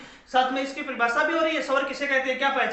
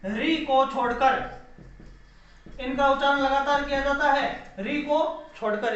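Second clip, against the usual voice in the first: usual speaking voice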